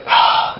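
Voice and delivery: voiceless fed-up huff; exasperated sigh. Acted. Fan tired of the same breakdowns, exasperated sigh. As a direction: sigh at the sameness of it.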